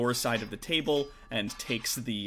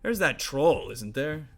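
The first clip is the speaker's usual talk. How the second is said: orc brother musing; 4 dB louder, much slower than usual. Acted. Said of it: "And he goes".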